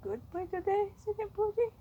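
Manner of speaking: high sing-song praise; cooing praise. Real, not acted. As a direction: praise the bunny in a high coo.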